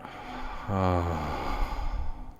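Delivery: long, open-mouthed exhaled sigh. Shown as a demonstration. Meditation teacher showing listeners how to exhale, long sigh. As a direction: sigh it all out slowly. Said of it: "I want you to sigh it out".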